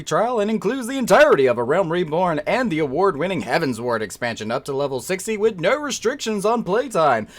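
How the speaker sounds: upbeat announcer ad voice; ad read. Acted. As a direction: sell it like a commercial. Recites the game ad.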